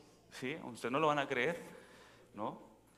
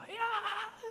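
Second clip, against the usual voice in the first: high-pitched imitated wailing scream; long, high mock wail; mock crying scream. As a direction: mimic a terrified passenger's shriek. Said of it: "llorando y gritaba".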